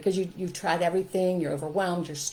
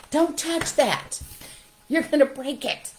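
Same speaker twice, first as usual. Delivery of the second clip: higher, louder, slow mock nag; anxious parent; 9 semitones higher, 6 dB louder, slower than usual. Parent warning a child off something fragile, mock nagging. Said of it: "some other things that I think parents say".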